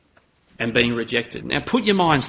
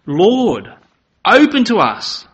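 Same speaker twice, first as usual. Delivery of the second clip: higher, louder desperate plea; pleading at the door; 8 semitones higher, 7 dB louder, slower than usual. Acted. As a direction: beg loudly to be let in. Souls knocking at shut door.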